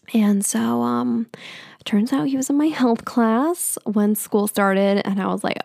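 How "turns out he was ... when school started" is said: usual speaking voice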